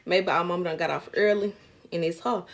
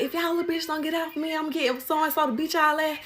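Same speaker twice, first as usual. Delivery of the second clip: higher, imitated threat from the intruder; mock threat; 8 semitones higher than usual. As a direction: mimic her threatening the kids. Lady threatening the girls.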